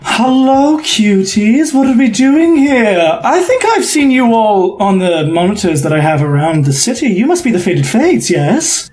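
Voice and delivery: high, flirty showman's greeting; lilting, theatrical host voice; flamboyant emporium host. Acted. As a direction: croon a coy welcome to new guests. Host Tullus greets the party.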